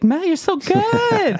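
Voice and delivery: put-on very high, drawn-out girly squeal; gushing high school girls. Musician mimicking high school girls praising his playing, high gushing squeal. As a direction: imitate adoring girls, stretched out and way up high.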